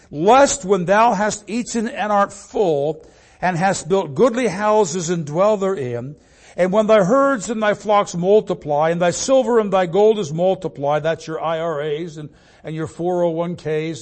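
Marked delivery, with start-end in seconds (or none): 0.1-11.0 raised, louder preacher's declamation; forceful scripture reading. Acted. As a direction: declaim the verse to the congregation, higher and louder. Reads scripture aloud with force.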